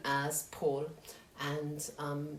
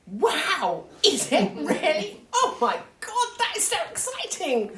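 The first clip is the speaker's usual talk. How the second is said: much higher, louder, gasping with a laugh; gushing excitement; 11 semitones higher, 10 dB louder than usual. Retold or imitated. To the date who just said his name, gushing and high. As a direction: squeal it like you can't believe your luck.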